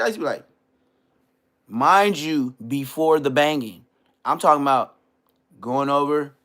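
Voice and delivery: ordinary talk, nothing marked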